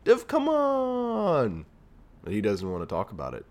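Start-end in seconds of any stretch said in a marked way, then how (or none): 0.0-1.7 much higher, very slow pleading whine; hammed-up begging voice; mock pleading. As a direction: beg your buddy in a high, drawn-out plea. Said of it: "I was like".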